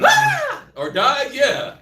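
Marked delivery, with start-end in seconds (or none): 0.0-0.6 shrill high mock scream; child's scream. Kid heard from another room, high mock scream.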